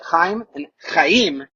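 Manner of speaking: slow Chaim with a guttural kh; Chaim, Chaim, each rasped and slow; throaty Chaim. Shown as a demonstration. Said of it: "that's how you say it".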